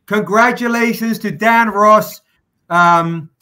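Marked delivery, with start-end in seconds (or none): 0.1-2.2 loud, high announcing of the winner; hyped livestream winner shout-out; excited announcement. Real, not acted. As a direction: call out the winner's name with a burst of excitement.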